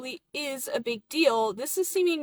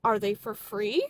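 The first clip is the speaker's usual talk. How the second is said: ordinary talk, nothing marked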